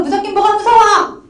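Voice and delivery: high, loud scolding father-God; a stern father brushing off his child's fear; scolding retort. Retold or imitated. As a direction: snap it back like a dismissive parent. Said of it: "이렇게 얘기하는 분이".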